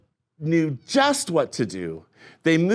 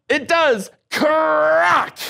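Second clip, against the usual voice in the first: high, loud cry of crack; thrilled shout as the egg cracks; excited; 12 semitones higher, 5 dB louder, much slower than usual. Acted. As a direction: burst out with the big crack. Second hatching take.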